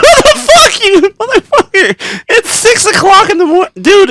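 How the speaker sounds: very high, loud hammed-up outrage; retold furious screech at the early hour; exasperated shouting. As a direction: yell in cracking disbelief, half laughing. Retold outburst at early waking.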